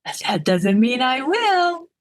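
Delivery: slow, high tipsy teasing; giggly. Acted. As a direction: sing it out slowly, rising playfully. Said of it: "I said with a giggle".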